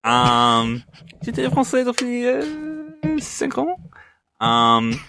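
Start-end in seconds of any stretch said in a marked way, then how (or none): 1.2-3.8 put-on English speaker's accent in French, pitched high; clumsy American-accented French; foreign accent in French. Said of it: "Please don't try to speak French".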